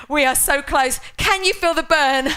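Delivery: loud, pumped countdown encouragement; rally the class through the burn, loud; energetic coach shouting. Real, not acted. Coach pushing class through final reps.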